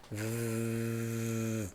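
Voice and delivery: a long buzzing V, lips humming; prolonged V sound. Shown as a demonstration. Teacher showing students the voiced V, long low buzz. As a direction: stretch the V out so it vibrates. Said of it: "vamos produzir o som do V".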